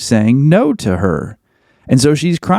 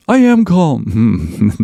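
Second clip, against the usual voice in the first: slow, drawn-out I am calm; a lightly voiced defensive spouse; slow mock protest; mock indignant reply; much slower than usual. Mock retort to the wife.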